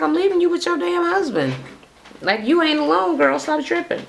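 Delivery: mock high brush-off. Son brushing off his mother.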